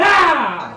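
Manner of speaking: sudden loud scream from above; ghostly scream. A narrator's reading. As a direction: let out a piercing scream. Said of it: "João escutou um grito". (grito fantasmagórico)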